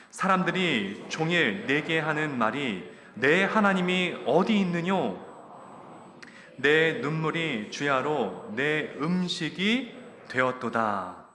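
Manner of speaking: loud, slow unison reading; congregational scripture reading. Real, not acted. Congregation reading Psalm aloud with the preacher, slow and loud. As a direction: read the psalm together, measured.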